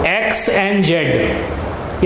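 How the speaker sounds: very slow, every word drawn out; slow dictation. Shown as a demonstration. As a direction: spell it out for the class to copy. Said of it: "लिखिए, therefore". (बहुत धीरे लिखवाना)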